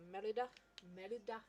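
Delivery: hammy attempt at Scottish; playful mock-Scottish lilt; bad Scottish accent. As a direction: put on a poor Scottish accent. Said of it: "bad Scottish accent".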